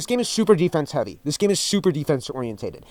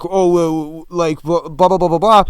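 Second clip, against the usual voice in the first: mocking blah blah; 4 dB louder, slower than usual. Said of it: "And you'd ask, like".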